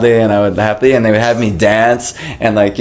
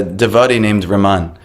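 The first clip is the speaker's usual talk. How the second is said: ordinary talk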